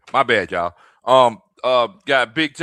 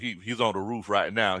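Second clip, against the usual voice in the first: ordinary delivery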